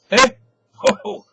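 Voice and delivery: very high, loud hail; calling out to rouse a drunk friend; rousing call. Acted. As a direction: shout to wake a sleeper. Bloom rousing sleeping Stephen.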